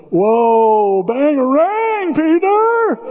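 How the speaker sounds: high, drawn-out whoop; imitated crowd cheer. All the Lost Boys cheering Peter's comeback, drawn-out whoop. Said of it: "everybody goes".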